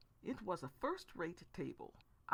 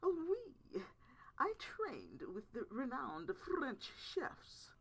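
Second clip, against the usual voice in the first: nose in the air, faux French pomp; hammed-up French snob boasting of chefs; snooty French accent; 9 semitones higher, slower than usual. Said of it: "I stuck my nose in the air".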